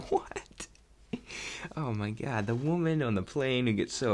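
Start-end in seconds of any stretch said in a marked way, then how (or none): 0.1-0.7 whispered what. Retold or imitated. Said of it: "I was like".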